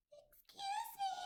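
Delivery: very high, polite little voice; mystery voice. Acted. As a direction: pipe up from the dark. Mysterious voice in cave.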